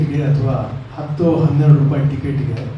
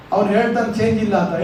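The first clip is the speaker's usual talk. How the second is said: usual speaking voice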